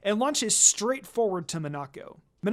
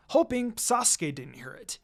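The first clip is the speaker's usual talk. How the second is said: plain reading voice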